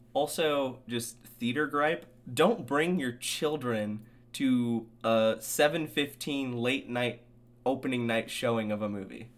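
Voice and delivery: usual speaking voice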